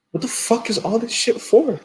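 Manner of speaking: high-pitched mock disbelief; retold bewilderment. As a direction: squeak the baffled question. Retelling his bewildered reaction in dream.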